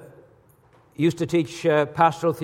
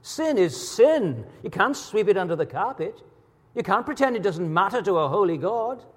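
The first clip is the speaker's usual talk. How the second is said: higher, emphatic proclamation as God; God declaring; 4 semitones higher than usual. Retold or imitated. Preacher voicing the Holy One rebuking sin, higher and emphatic. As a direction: preach it with swooping, weighty stresses. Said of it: "he says".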